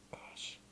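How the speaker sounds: soft whispered sigh after crying; shaky tearful sigh. Real, not acted. Catching her breath after crying, whispered sigh. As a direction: breathe out the tears quietly. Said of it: "getting so emotional".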